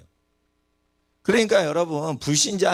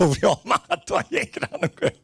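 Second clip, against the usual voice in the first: talking through real chuckles; laughing; 6 semitones higher, faster than usual. Real, not acted. Preacher telling congregation about his mother's complaint, laughing. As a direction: let the laughter break up the words. Amused telling of family story.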